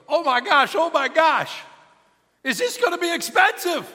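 put-on high, loud, frantic kid voice; panicked teenage kid. Teen who rear-ended the pastor's Cadillac, high and frantic. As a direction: play the panicking seventeen-year-old. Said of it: "And he goes".

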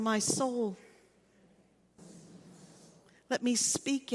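measured, reverent prayer with long pauses; prayer of confession. Real, not acted. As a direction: lead a congregation in prayer, phrase by phrase.

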